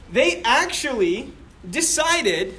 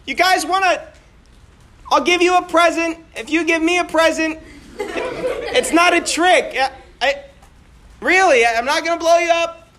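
higher, earnest pleading voice; a hint of a nervous soldier reassuring enemies; nervous offer; 6 semitones higher than usual. Soldier offers gift across lines.

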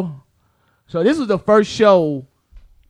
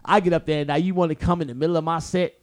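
ordinary talk

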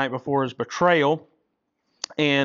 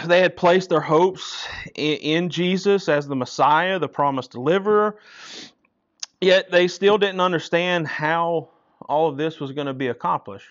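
louder, higher, rousing preacher's call; preaching at full force; 5 semitones higher, 5 dB louder than usual. Acted. Preacher rousing the congregation about the disciples, louder and higher. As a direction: lift the room with this.

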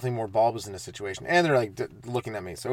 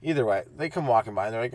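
ordinary talk, nothing marked

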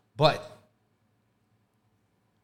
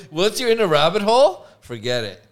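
normal talking voice

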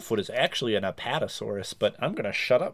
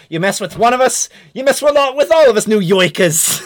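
loud, high New York shout; rally the crowd like a New Yorker; New York accent; 8 semitones higher, 10 dB louder than usual. Retold or imitated. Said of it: "like in Spider-Man One".

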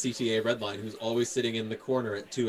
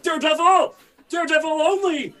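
much higher, louder hammed-up cheering; mock fan shout; 11 dB louder than usual. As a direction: yell the hero's name like a frenzied fan. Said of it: "they're like".

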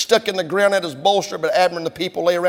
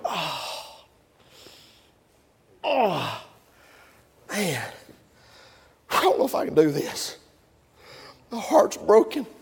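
weary sigh; 6 dB quieter, slower than usual. Acted. Preacher voices weary David.

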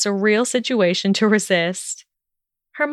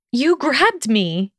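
higher, drawn-out indignant objection; protesting; 5 semitones higher, slower than usual. A narrator's reading. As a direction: protest slowly, pitch lifted. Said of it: "she protested".

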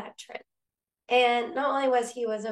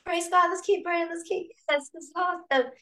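high, bright rush of praise; imitating an excited prayer partner; 8 semitones higher than usual. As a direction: cheer her on, thrilled. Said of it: "She's so excited".

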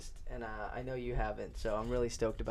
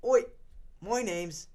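higher, drawn-out Aussie introduction; mock Australian accent, slow and raised; 4 semitones higher, 4 dB louder, slower than usual. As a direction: redo the bad Australian accent, stretched out. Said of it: "the introduction one more time".

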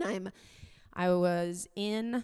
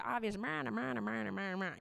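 dismissive mock babble "meh, meh"; mocking judgment; 3 semitones higher than usual. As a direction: wave them off with scornful gibberish. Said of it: "I had thought things like".

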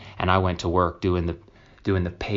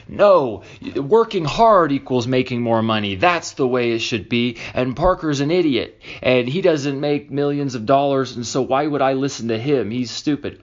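louder, higher mock rant of a dismissive critic; mocking a hater; 6 semitones higher, 5 dB louder than usual. Mimicking a podcast critic who calls Parker an idiot, loud mock scorn. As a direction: sneer it out like a know-it-all who thinks you're a fool. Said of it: "the type who are like".

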